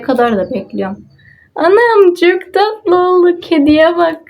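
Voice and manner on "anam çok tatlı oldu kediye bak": high, slow cooing; high slow coo of delight. Real, not acted. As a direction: coo over something adorable. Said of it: "delighted by cute result".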